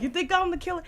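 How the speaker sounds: high, pushy retort, "I'm" pressed hard; mock accusing challenge. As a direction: throw the accusation back at her, high. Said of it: "you grab her and you're like".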